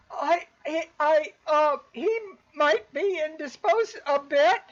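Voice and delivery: high, loud flustered stammer meant to be overheard; stammering loudly. Acted. Maid stalling the visitors, stammering loudly toward the house. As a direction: raise the voice so the master hears. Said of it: "she's saying it kind of loud as if she's hoping somebody will hear".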